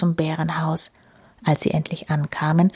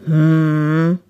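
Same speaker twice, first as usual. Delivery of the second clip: louder, long humming rumble; bear's mumbling grumble; 2 semitones lower, 6 dB louder than usual. A narrator's reading. Bear weighing the hare's request, long grumble. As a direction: hum like a bear thinking it over. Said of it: "brummelte der Bär".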